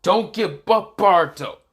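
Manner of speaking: high, loud rallying call; urgent encouragement. Acted. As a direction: call out to spur on a faltering fighter. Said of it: "this is where he hears a voice".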